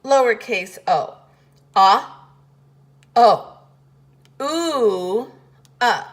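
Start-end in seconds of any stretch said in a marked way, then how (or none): 1.8-6.0 loud, long drawn ah, O, ooh, uh; vowel sounds of O. Shown as a demonstration. Teacher sounding out the four sounds of O. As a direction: sound out each vowel of O slowly. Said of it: "Uppercase O".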